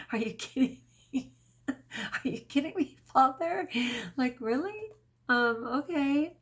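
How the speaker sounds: high, laughing, can't believe it; laughing disbelief. Retold or imitated. Telling viewers how she reacted to God, high laughing disbelief. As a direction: squeal through laughter at the absurd request. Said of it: "And I'm like".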